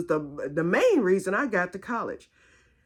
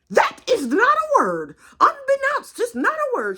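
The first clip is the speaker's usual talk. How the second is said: loud, very high scolding correction; Aunt Ada; 7 dB louder than usual. Retold or imitated. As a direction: imitate a proper aunt emphatically overruling you. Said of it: "That's just how she talks".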